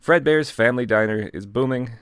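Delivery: ordinary delivery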